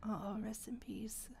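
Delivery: slow, soft mock-solemn whisper; hushed mock condolence to a viewer. Real, not acted. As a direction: whisper it like a tiny funeral. Condoling viewer who lacks Durin.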